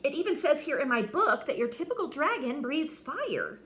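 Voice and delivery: high, loud whining complaint; whiny knight moaning. Acted. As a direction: moan about his father. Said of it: "Sir George moaned".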